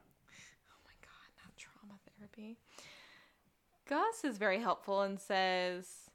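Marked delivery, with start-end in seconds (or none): 0.0-2.6 voiceless whisper, softer. Real, not acted. Reacting to billing the chief for trauma therapy, whispered. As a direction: hush it like a shocked aside. Mock horror at the bill.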